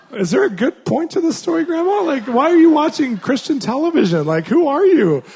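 incredulous. Retold or imitated. Incredulous retort to grandma.

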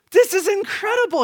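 high, loud burst of amazement; marvelling proud-dad exclamation; amazed. Real, not acted. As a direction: exclaim it, pitch leaping up in wonder. Marvels at his son's creation.

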